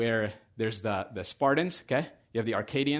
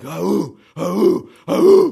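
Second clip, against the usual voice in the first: loud warrior roar, climbing higher; terrifying bellow; 6 semitones higher, 16 dB louder, slower than usual. Acted. Spartan army answering their commander, fierce bellowed roar. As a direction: roar a battle chant, each cry bigger. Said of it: "very terrifying bellow, a roar of".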